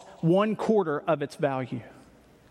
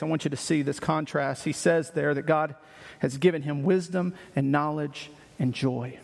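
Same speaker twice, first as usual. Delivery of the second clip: ordinary delivery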